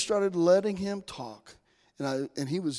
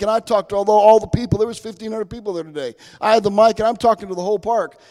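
proud excitement; 4 semitones higher, 10 dB louder than usual. Real, not acted. Proudly recalling preaching to the crowd.

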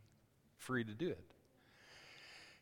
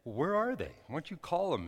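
higher, louder imitation of an impatient dad; irritated dad; 8 semitones higher, 5 dB louder than usual. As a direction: push the questions up like an irritated father. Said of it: "my dad's starting to get irritated".

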